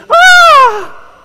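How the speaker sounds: loud, very high scream; imitated scream. Husband imitates wife's scream at a mouse, piercing scream. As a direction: shriek like a startled wife, hammed-up. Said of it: "I heard her scream".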